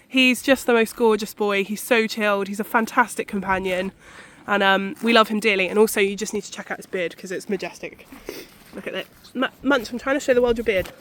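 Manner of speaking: speaking outside in strong wind; windy outdoors. Real, not acted. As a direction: talk over wind noise in a field. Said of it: "You have to excuse the wind noise".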